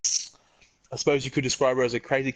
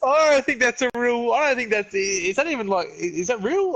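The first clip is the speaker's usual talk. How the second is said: high, stunned questioning; can't believe what he's hearing; shocked disbelief; 7 semitones higher than usual. Real, not acted. Disbelief at a gross story.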